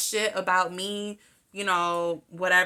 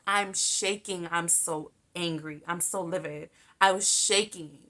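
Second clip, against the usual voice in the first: ordinary delivery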